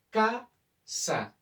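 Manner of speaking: ca-sa, split into two beats; syllable-split. Shown as a demonstration. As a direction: say casa as two clear syllables ca-sa. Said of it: "yo voy a nombrar dos golpes de voz".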